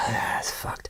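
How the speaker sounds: whispered curse under breath. Real, not acted. Sighed whisper after swearing.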